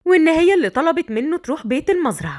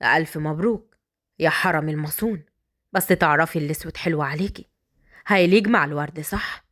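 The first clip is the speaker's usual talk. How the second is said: low, taunting congratulations; teasing bridegroom, voice dropped; provocative; 8 semitones lower, 3 dB quieter than usual. Acted. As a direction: needle her in a deep, softened voice. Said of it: "قال لها باستفزاز".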